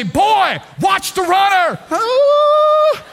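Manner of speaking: dad yells from the passenger seat in alarm; yelling dad. Retold or imitated. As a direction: shout the warning in panic. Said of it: "Dad is yelling at me".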